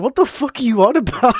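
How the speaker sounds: loud, high-pitched disbelief; incredulous outburst. Retold or imitated. Worker reacting to coworker's torture talk, incredulous outburst. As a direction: blurt it out, appalled and amused. Retells his appalled reaction.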